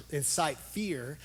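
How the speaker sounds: ordinary delivery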